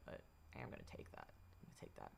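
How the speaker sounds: mock-secret whisper of I am gonna take that; conspiratorial whisper; whispered aside. Real, not acted. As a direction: breathe it like a sly secret. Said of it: "jokingly stealing a phrase".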